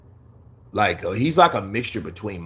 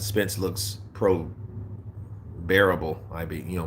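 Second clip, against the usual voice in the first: a lazy, slurred hint of Spence; Errol Spence impression; 6 semitones lower, 7 dB quieter than usual. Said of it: "Errol Spence impression".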